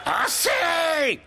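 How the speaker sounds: high furious shout; a prince losing his temper in a shout. A narrator's reading. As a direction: snap the crowd silent with a yell. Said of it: "hurla le prince Jean".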